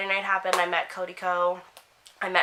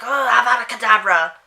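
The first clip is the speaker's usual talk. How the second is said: mock dark-wizard curse, louder; Voldemort; 4 semitones higher, 10 dB louder than usual. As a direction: cast it on everyone, lightly voiced dark lord. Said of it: "turn into Voldemort and just be like".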